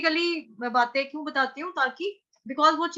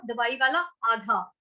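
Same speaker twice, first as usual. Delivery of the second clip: ordinary talk, nothing marked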